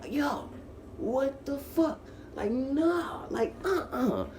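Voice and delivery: very high, stretched-out mock yell; retold screaming. Detainee protesting to officers behind the glass, mock scream. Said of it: "I'm screaming in that bitch".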